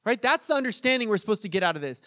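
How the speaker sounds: ordinary talk, nothing marked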